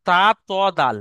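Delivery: loud, exaggerated Arabic letters ta, to, dal; Arabic letter demo. Shown as a demonstration. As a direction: sound out তা, ত, দাল with the tongue tip on the upper gums. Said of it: "তিনটে অক্ষর দিয়েছে".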